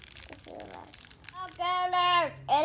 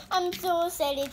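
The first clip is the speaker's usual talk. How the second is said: normal talking voice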